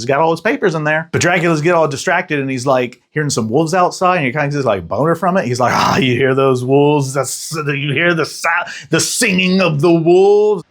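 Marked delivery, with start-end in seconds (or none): 5.7-10.6 Dracula voice. Retold or imitated. Imitating Dracula hearing wolves.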